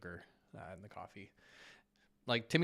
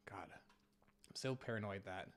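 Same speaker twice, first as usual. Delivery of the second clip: whispering; 2 semitones lower, 10 dB quieter, slower than usual. Real, not acted. Nervous aside about stream.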